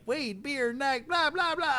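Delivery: squeaky high mimic of a friend; high mock gabble; mock imitation. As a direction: parody his rambling in a silly high voice. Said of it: "he's like".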